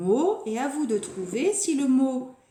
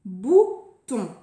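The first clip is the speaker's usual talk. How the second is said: "Bouton" louder, in two short beats; word announcement; 7 dB louder than usual. Shown as a demonstration. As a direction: present "Bouton" clearly with a brief gap. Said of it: "Nouveau mot".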